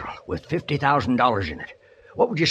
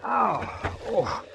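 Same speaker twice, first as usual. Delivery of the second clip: high, pleading cry sinking into moans; pained panic; 8 semitones higher, much slower than usual. Acted. Injured man begging to be put down, pained panic. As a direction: protest in agony, then groan. Broken leg hurts when lifted.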